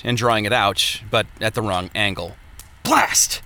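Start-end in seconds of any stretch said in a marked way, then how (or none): 2.8-3.4 sharp high outburst; frustrated curse. Acted. Smith cursing a botched hammer stroke, sharp and high.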